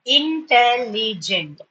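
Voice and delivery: "Intelligent" drawn out as in-tel-li-gent; four slow beats on "Intelligent"; syllable by syllable. Shown as a demonstration. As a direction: break "Intelligent" into clear syllables.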